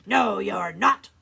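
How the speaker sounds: slow, booming troll refusal. Acted. Troll refuses the second goat, slow and booming. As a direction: thunder each word slowly. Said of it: "said the troll".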